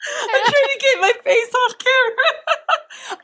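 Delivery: high, slow, squeaky through laughter; can barely speak for laughing. Real, not acted. Laughing hard.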